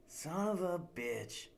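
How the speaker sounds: slow, drawn-out groan of a curse; exasperated curse. Acted. Jasper told to climb back up, exasperated. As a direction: drag it out, fed up.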